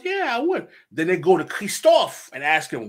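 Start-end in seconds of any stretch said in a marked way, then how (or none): 0.0-0.7 eager agreement. Retold or imitated. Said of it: "And Pétion says".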